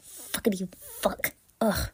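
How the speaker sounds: usual speaking voice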